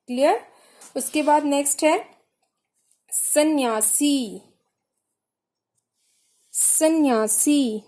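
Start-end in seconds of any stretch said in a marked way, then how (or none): 3.1-7.8 सन्यासी said slowly twice, syllables clear; teacher dictating सन्यासी; slow dictation. Shown as a demonstration. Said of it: "देखिए सा".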